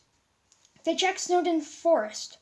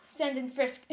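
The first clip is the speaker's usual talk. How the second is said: plain reading voice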